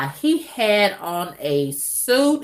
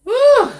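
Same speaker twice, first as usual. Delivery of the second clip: high loud whew; 6 dB louder than usual. Real, not acted. Exhale after laughing.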